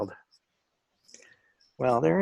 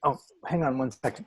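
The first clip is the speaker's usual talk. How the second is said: ordinary delivery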